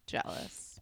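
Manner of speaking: whispering; whispered. Real, not acted. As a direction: breathe the word unvoiced. Whispered echo.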